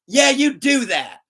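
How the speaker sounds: high, loud, drawn-out dare; mock aggressive challenge. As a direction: imitate a friend defiantly daring the clerk. Retelling friend's aggressive reply to clerk.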